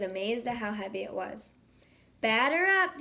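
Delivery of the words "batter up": impatient shout. Acted. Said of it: "the umpire shouted impatiently".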